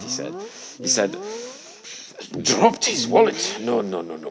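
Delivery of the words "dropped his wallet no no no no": high, loud, acted disbelief; shocked. Shocked man hears about the dropped wallet, disbelieving. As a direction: voice a man shocked into repeated denial. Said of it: "And the guy was shocked. Shocked".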